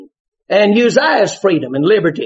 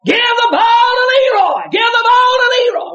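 fans hollering. Retold or imitated. Fans in the stands yelling at the coach. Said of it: "all fans started hollering".

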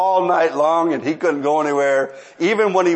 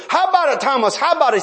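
much higher, pressing plea; begging coworker Thomas, much higher and pressing; urgent begging; 11 semitones higher, faster than usual. Retold or imitated. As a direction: push him hard to say yes. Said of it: "I begged, I pleaded".

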